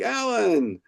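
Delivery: high-pitched hail, dropping at the end; answering greeting. Retold or imitated. Author answers the attorney's call with a high-pitched greeting.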